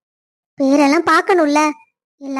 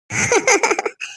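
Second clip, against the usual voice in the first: a light laugh pitched higher; amused laugh; 5 semitones higher, 3 dB quieter than usual. Acted. Mother laughing about the baby finally sleeping, light laugh. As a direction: laugh it off, tired but amused.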